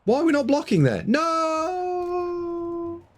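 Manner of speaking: high-pitched cry of disbelief, ending in a long held "no"; dismayed wail. Real, not acted. Commentator watching a player skip the block, dismayed wail. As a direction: wail it high, stretching the last word right out.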